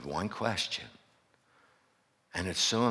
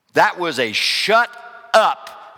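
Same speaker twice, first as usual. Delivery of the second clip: emphatic; 8 semitones higher, 10 dB louder, slower than usual. Real, not acted. Emphatic exclamation.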